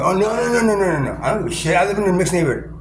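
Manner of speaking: high, loud, dismissive acted voice; hammed-up indifferent juror; mock juror. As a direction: brush it off like a careless juror. Voicing a would-be juror.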